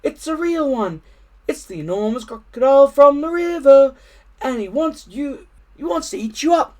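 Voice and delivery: high, urgent bird voice; frantic little bird warning. A narrator's reading. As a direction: squawk the danger to Jill. Bird warns Jill.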